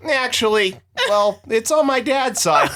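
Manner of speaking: high, slow mock earnestness; imitated American tourist. Tourist correcting the bartender, imitated and high-pitched. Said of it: "one of the guys goes".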